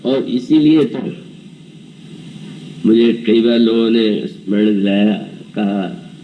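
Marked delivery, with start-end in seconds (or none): none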